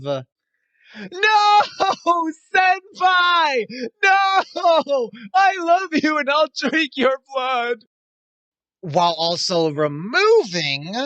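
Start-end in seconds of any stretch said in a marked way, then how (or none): 0.0-7.8 overacted anime dub. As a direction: shout it like a melodramatic heroine. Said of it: "comes at the cost of".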